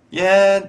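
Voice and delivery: hissy open hi-hat with an s tail. Shown as a demonstration. Teacher demonstrating the open hi-hat with hissing air. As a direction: let air hiss through the teeth. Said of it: "اوپن های هت".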